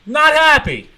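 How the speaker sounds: high, loud punchline; high, loud final word; loud emphasis. Real, not acted. Emphatic closing of the rant.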